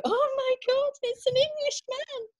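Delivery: high, squealing mock French lady gushing; excited French ladies. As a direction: squeal it like a starstruck Parisian. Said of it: "then they just go".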